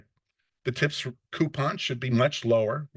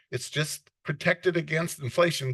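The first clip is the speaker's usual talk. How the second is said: ordinary talk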